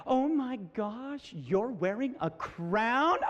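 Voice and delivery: put-on slow, drawn-out mean-girl disbelief; mocking lead girl. Lead girl ridiculing the daughter's crown, mocking. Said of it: "she's like this".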